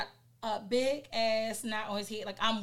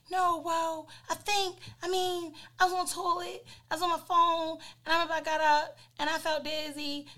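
husband excuse; 7 semitones higher than usual. Retold or imitated. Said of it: "he's like".

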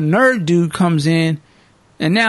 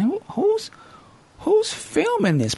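high, incredulous question; incredulous squeak at the movie; disbelieving; 4 dB quieter, slower than usual. Retold or imitated. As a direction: pitch way up in disbelief. Disbelieving reaction.

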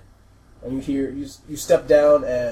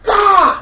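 high, loud "Ah!"; acted attack yell; 12 dB louder than usual. Gretchen slashes and runs off, high yell. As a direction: let out a sharp yell. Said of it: "And he's like".

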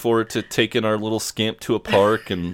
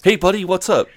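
cheery greeting; 10 semitones higher, 4 dB louder than usual. Retold or imitated. Acting out a coworker greeting.

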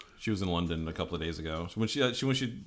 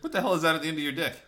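mock alarm; 9 semitones higher than usual. Acted reaction in improvised bit.

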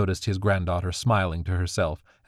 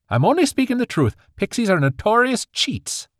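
put-on higher, louder defensive old man; 5 dB louder than usual. Grandfather defends his slur against pixies, higher and louder. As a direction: insist you're right, defensively. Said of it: "Kale said defensively".

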